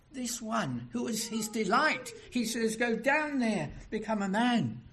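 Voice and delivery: fervent sermon voice; impassioned preaching. Acted.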